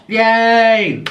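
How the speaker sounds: high, loud happy yay; excited cheer. Real, not acted. Cheering about new mic.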